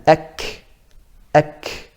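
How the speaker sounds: ek with the k a bit forward; forward kaf demo. Shown as a demonstration. Teacher contrasting ek with ako. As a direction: ek touching the hard palate. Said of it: "that's touching a bit forward".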